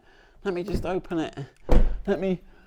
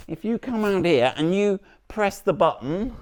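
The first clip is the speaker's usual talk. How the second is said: ordinary delivery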